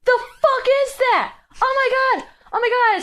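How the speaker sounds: high, loud, panicky rising shriek; scared shrieking. Retold or imitated. Girl spots the figure in the hallway: frightened high squeal. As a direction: squeal in fright at a creature in the dark. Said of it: "She's like".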